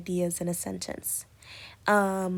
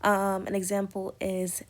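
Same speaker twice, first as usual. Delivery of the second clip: ordinary delivery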